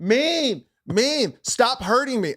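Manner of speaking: high-pitched mock wail of a child; whining son. Son embarrassing his parent in public, high mock wail. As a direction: imitate a kid making a public scene. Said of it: "he knows how to embarrass me in public".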